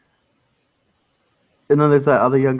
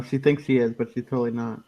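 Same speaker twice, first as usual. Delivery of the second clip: normal talking voice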